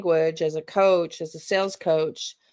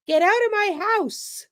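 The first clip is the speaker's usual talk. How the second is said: less confrontational; 13 semitones higher than usual. Shown as a demonstration. Coach showing a hesitant homeowner, high and less confrontational. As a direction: ease off, keep it up high. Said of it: "is less confrontational".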